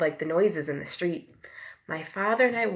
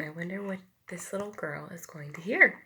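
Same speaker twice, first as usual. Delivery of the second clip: ordinary delivery, nothing marked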